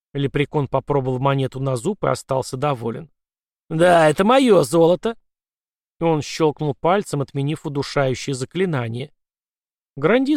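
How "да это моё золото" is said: loud high triumphant cry; gleeful leprechaun. Acted. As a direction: claim the gold with glee. Leprechaun recognises his gold.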